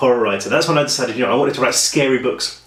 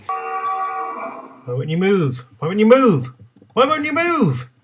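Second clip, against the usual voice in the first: high-pitched whine, rising in frustration; exasperated gamer; 7 semitones higher, much slower than usual. Acted. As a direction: plead helplessly with the game character. Acts out failing at video game.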